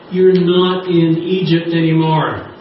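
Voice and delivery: slow, sung-out preacher line; slow emphatic declaration. Acted. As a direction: draw it out like a proclamation. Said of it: "Can you nudge the person beside you and say".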